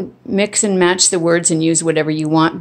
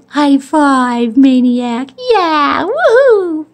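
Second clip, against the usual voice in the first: high, louder whooping praise for the dog; excited dog praise; 8 semitones higher, 4 dB louder, much slower than usual. Real, not acted. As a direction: cheer the dog on like a fan.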